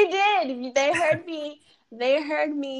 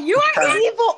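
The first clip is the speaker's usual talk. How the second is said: loud playful accusation; mock outrage; 6 semitones higher, 7 dB louder than usual. Real, not acted. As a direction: shout it in fake outrage.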